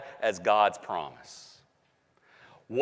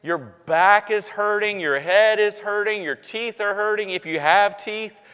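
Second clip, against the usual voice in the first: preaching; 4 semitones higher than usual. Acted. Pastor promising the congregation a new body, preaching.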